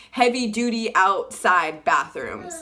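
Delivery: ordinary delivery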